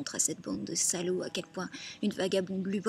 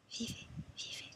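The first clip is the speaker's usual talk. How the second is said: very soft, whispered echo; hushed voice of the dead; 12 dB quieter than usual. Acted. Woman passing on what the dead say, hushed whisper.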